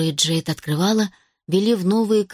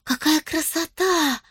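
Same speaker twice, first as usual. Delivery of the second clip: slow, higher delighted gasp; 8 semitones higher, slower than usual. A narrator's reading. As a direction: marvel at it like an elf. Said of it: "восхитилась Харпер".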